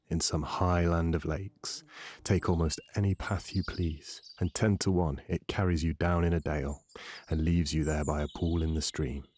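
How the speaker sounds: ordinary delivery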